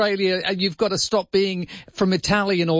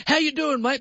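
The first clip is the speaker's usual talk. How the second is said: higher-pitched, lightly imitated Aussie greeting; Australian vernacular; 7 semitones higher than usual. Imitating immigrants who want to assimilate, lightly put-on Australian vernacular. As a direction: put on a matey Australian hello. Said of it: "bring in the Australian vernacular".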